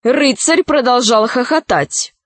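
ordinary delivery, nothing marked

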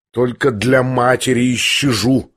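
ordinary delivery, nothing marked

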